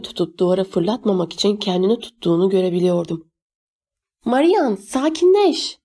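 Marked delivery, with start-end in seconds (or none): 4.2-5.8 high-pitched, restrained yell, last word drawn out; father's shout, acted lightly by a narrator; narrated shout. A narrator's reading. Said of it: "diye bağırdı babam".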